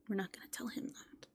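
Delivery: a sly secret kept from the ghost; hushed aside to the viewers; conspiratorial whisper. Acted. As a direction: whisper it behind his back.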